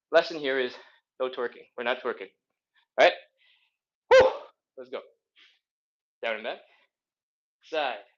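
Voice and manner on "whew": short, sharp puff of breath; whew. Real, not acted. Coach bracing before the first set, whew. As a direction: blow out a quick whew.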